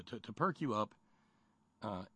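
ordinary talk, nothing marked

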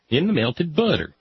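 ordinary talk

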